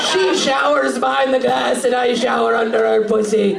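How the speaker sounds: put-on slow, proclaiming Scottish accent. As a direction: boast grandly with a Scottish accent, words stretched out. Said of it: "I'm giving him a Scottish accent".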